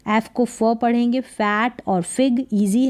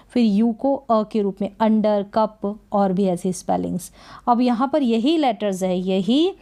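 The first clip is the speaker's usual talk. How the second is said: ordinary talk, nothing marked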